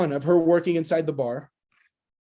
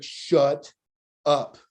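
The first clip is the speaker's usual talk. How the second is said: lower, two words split by a pause; amazed disbelief; 3 semitones lower than usual. Retold or imitated. Boss overhearing his new hire's questions, amazed. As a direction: draw out the disbelief, pausing before the last word.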